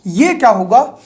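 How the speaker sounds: usual speaking voice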